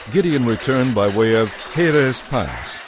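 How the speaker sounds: normal talking voice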